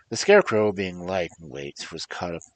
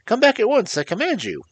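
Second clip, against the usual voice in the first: high, loud urgent command; commanding shout; 10 dB louder than usual. Acted. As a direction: order it back at the top of your voice. Tip calls to the flying Gump.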